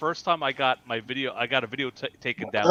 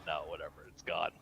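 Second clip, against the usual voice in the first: low, quiet mock dismissal; dismissive brush-off; 9 semitones lower, 17 dB quieter than usual. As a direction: imitate an indifferent refusal, much softer and lower. Retelling YouTube brushing off his appeal.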